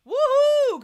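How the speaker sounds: high, loud whoop; excited cheer. Real, not acted. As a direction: cheer them on. Cheering a viewer's streak.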